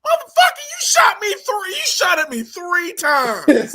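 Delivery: angry mock yell breaking into laughter; furious shout. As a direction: yell at the father who shot you. Shouting at the movie dad.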